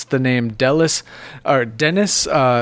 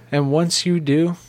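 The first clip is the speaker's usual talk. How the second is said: ordinary delivery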